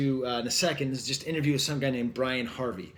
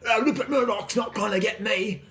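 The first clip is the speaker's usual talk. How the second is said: high-pitched paranoid Cockney rant; hammed-up Cockney defiance; bad Cockney accent; 7 semitones higher, 4 dB louder than usual. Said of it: "oh, that's a bad accent".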